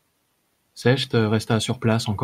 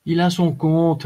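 tossed out higher, briskly dismissive; higher-pitched offhand call; 7 semitones higher, 3 dB louder, slower than usual. A narrator's reading. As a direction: toss the verdict across to a companion. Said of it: "lança Dugam".

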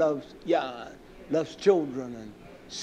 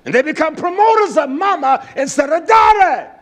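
raised, forceful sermon voice; fiery preaching; 10 semitones higher, 8 dB louder than usual. Real, not acted. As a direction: build to shouted denunciation from the pulpit.

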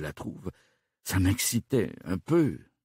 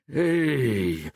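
long drawn-out, higher-pitched call; understated yell; 5 semitones higher, 4 dB louder than usual. A narrator's reading. Inspector interrupting the panicking suspect, a contained yell. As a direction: cut him off with a stretched, contained holler. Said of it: "hurla encore l'inspecteur".

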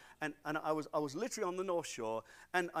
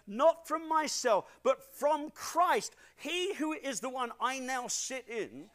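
loud, high preaching voice; full-force preaching; 6 semitones higher, 7 dB louder, slower than usual. Acted. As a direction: preach it out to the whole church. Preaching climax.

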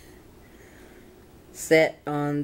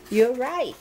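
pitched up high with delight; second time, brighter and much higher; excited; 8 semitones higher than usual. A narrator's reading. Little Bill gleefully agreeing with Bobby, said up high.

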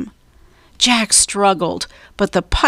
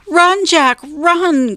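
high, urgent repeated warning; crying out; 9 semitones higher, slower than usual. A narrator's reading. Annie watches pirates charge at Jack, high alarmed cry. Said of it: "cried Annie".

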